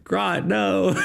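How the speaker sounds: amused. Real, not acted. Laughing at a bad charisma roll. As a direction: still giggling through the words.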